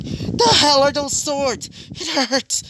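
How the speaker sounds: high, stretched cry of agony; acted scream in pain. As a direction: wail it high as if being slashed. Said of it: "he would scream out in pain".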